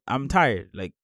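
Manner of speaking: ordinary talk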